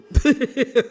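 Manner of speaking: loud high laugh at her own joke; cackling at the burn; burst of laughter. Real, not acted. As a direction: break into a big laugh.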